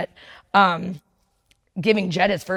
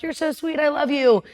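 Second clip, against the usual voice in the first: put-on cooing to baby; 6 semitones higher than usual. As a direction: coo it like holding a newborn. New mom cooing to her baby.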